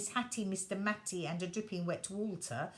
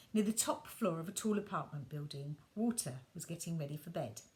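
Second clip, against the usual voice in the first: plain reading voice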